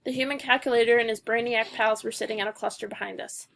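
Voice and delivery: usual speaking voice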